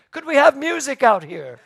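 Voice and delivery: high, loud imitation of a yelling kid; retold child's yell. Seven-year-old yelling into the living room, imitated high shout. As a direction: call into the next room like a small boy. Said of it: "this little seven-year-old yelled into me".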